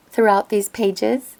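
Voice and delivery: normal talking voice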